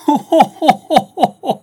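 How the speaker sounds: high, loud staccato laugh; mocking theatrical "ho-ho" laughter; peal of laughter. A narrator's reading. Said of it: "fit-elle en riant aux éclats".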